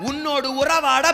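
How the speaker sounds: booming divine voice in a sermon; preaching as God. Acted. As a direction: proclaim God's promises over the crowd.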